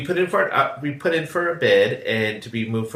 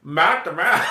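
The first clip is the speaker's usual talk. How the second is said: hammed-up Australian, pitched up; mock Aussie accent with raised pitch; bad Australian accent; 5 semitones higher, slower than usual. Shown as a demonstration. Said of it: "how do you say it in Australian?".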